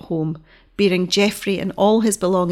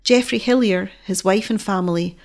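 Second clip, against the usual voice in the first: ordinary delivery, nothing marked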